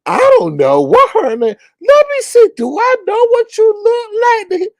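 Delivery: very high, loud squealing mimicry; Kimmy. Host mocks Kimmy's claim of ignorance, squealing voice. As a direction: squeal the denial in exaggerated falsetto.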